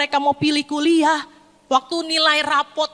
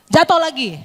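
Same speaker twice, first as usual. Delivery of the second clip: loud, higher fed-up outburst; exasperated parent; 4 semitones higher, 7 dB louder than usual. Acted. Parent seeing the son fall again, exasperated. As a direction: snap at the repeated fall.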